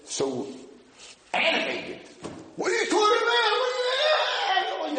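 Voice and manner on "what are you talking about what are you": high, loud, heated Torah argument; mock yeshiva yelling. Study partner shouting back at his friend, high and loud. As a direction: yell it across the study hall.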